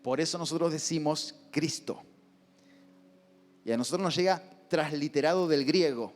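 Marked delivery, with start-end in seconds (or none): none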